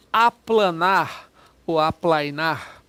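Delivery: each syllable drawn out slowly; slowly spelled out. Shown as a demonstration. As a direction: say it slowly so the class hears the prefix. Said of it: "teacher shows word". (palavra dita bem devagar, sílaba por sílaba)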